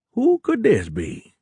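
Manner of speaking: high, slow Cajun drawl; thick Cajun accent. A narrator's reading. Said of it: "he said in a thick Cajun accent".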